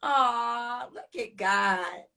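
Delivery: hushed awe. Real, not acted. Host after the guest leaves, a slow hushed murmur.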